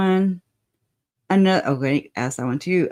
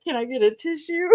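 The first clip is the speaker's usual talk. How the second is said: high, mock whimpering request; mock tissue plea; 10 semitones higher, faster than usual. Co-host quoting guest's fake tearful request, higher. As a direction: ham up the pitiful plea. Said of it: "And so you're like".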